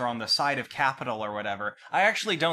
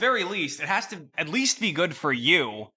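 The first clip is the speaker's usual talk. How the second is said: usual speaking voice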